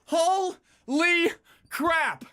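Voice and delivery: slow, high, drawn-out amazement; stunned awe. Real, not acted. Streamer stunned by the result, slow high awe. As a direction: let it hang in disbelief. Reacting to game outcome.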